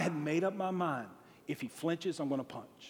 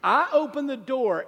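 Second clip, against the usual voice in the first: ordinary talk, nothing marked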